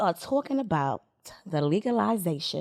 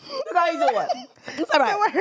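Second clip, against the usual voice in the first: laughing while saying Look how you do us; giggly higher repeat; 7 semitones higher than usual. Real, not acted.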